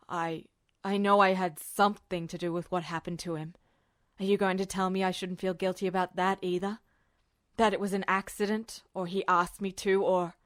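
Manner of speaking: worried officer pressing a question. A narrator's reading. Narrator voices Roy.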